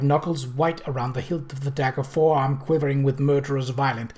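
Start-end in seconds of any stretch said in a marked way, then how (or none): none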